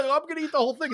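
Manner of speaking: light, laughing-edged mock sob; mock crying. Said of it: "like, crying".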